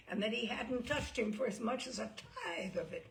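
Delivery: ordinary delivery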